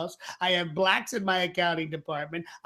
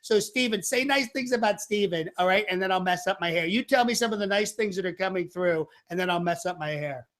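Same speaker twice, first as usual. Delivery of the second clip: rambling, boastful Trump; Trump impression. Trump asks YouTube viewers for praise, Trump impression.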